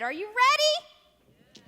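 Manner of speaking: slow, high, drawn-out question; rally the kids up; excited. Acted. Rallying children to tables.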